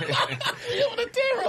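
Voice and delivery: high, slow words through laughter; laughing. Real, not acted. Friend laughing at the pundit's story, high laughing. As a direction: squeeze it out mid-laugh.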